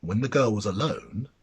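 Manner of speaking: ordinary delivery, nothing marked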